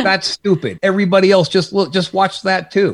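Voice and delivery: pitched high, scoffing at lazy shows; mock scorn. Real, not acted. Podcaster to hosts, mocking other wrestling shows, scoffing high. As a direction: sneer at the watch-and-talk crowd.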